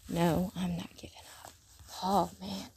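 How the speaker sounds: hushed, breathy whisper of awe. Real, not acted. After reading Paul to viewers, an awed whisper. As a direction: whisper it, moved by the verse.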